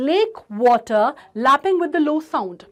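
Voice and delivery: slow, "lapping" hit hard; stressing the l sounds. Shown as a demonstration. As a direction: show the l repeat by pressing "lake". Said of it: "पहला alphabet हो रहा है I hear". ("lake" और "lapping" पर ज़ोर)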